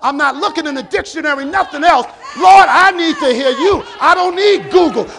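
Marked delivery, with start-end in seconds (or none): 2.3-5.1 loud pleading sermon cry, pitched up; preaching at full force. Acted. Preacher crying out to the Lord before the congregation, loud and fervent. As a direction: call out to the Lord with fervour.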